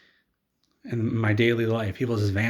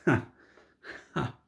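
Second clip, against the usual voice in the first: dry, spaced-out ha ha; mock witch laugh; 3 semitones higher than usual. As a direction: fake a scornful laugh, one ha at a time. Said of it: "all the witches laugh and they say".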